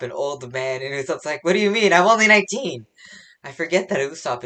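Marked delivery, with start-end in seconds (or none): 1.4-2.9 loud, high-pitched mock indignation; indignant protest. Usopp objecting to being called an old man, indignant. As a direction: act out an offended protest, raised and loud.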